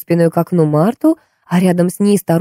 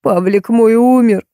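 sobbing; 5 semitones higher, slower than usual. A narrator's reading. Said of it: "всхлипнула она".

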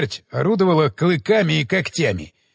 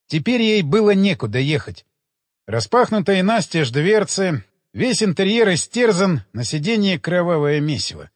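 ordinary delivery, nothing marked

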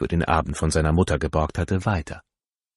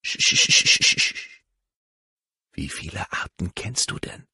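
hushed voiceless whisper so the birds stay. A narrator's reading. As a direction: whisper it close, barely breathing. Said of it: "flüsterte er".

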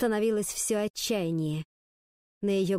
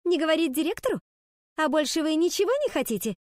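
higher, indignant outburst; worked-up; 7 semitones higher, 4 dB louder than usual. A narrator's reading. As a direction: flare up, high and sharp. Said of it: "Снова взвинтилась Пенни". (взвинченно, возмущённо)

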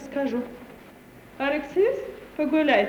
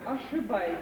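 low and much softer, one quiet word; cool rebuff; 7 semitones lower, 11 dB quieter than usual. Acted. Companion quietly rebuffing the young man's boast, cold and soft. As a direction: shut him down quietly.

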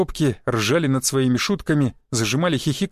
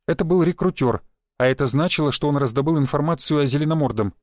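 plain reading voice

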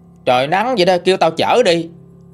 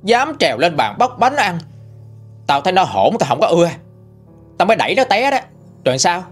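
furious scolding; 5 semitones higher than usual. A narrator's reading. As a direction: shriek abuse at the daughter-in-law. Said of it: "bà Năm chửi rùm trời luôn".

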